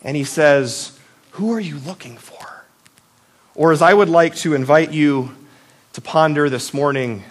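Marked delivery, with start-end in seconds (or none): 1.3-2.6 hushed, breathy whisper of the question; soft secret whisper to Mary. Acted. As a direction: lean in and whisper it gently. Jesus's question retold, hushed.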